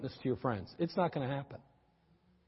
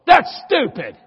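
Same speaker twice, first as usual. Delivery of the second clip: "That's stupid." spat out much higher and louder; harsh contemptuous snap; 10 semitones higher, 14 dB louder, slower than usual. Shown as a demonstration. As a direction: say "That's stupid." as a cutting insult to the child. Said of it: "There's a difference".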